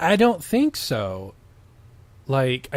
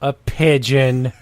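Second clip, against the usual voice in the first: voiced reply; 5 dB louder, slower than usual. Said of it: "[name] replied".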